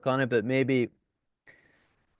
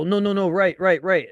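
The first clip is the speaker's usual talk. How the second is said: urgent correction; 6 semitones higher, 5 dB louder than usual. Retold or imitated. Correcting a driver's turn.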